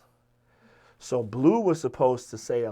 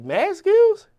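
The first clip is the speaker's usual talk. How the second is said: mock astonished, much higher and louder; surprised; 9 dB louder than usual. Jay hearing the writer's name, squealing in surprise. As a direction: squeal the name in imitated disbelief.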